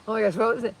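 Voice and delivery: ordinary talk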